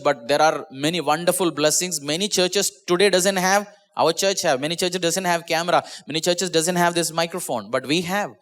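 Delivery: usual speaking voice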